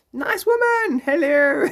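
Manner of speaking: very high, loud put-on woman's greeting; nice woman character. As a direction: call out as the squeaky nice woman. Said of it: "I'm just a nice woman".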